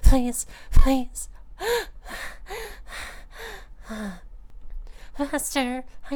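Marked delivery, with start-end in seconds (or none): 0.0-1.3 loud begging. Acted. Begging in roleplay. 1.3-5.1 drawn-out high breathy gasp that falls; long high gasp. Acted.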